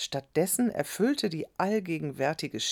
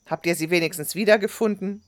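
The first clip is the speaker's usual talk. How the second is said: fast, raised, cross question; glaring demand; 4 semitones higher, 6 dB louder, faster than usual. A narrator's reading. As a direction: demand an answer quickly and loudly. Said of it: "Amina funkelte den Jungen an". (gereizt und schnell nachfragend)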